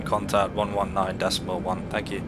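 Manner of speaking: normal talking voice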